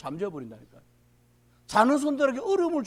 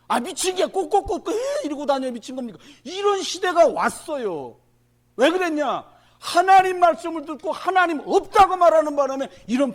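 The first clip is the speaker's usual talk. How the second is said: preaching at full force; 9 semitones higher, 10 dB louder than usual. Acted. Pastor warning the congregation about the times, loud and high.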